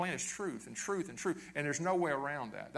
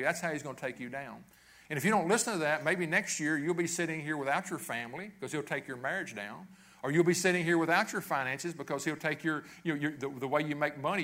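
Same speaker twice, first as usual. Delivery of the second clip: ordinary talk, nothing marked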